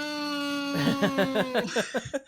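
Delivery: squealing burst of laughter; high shrieking laugh. Real, not acted. Game master shrieking with laughter at her player's despair. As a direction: shriek with delight at his misery.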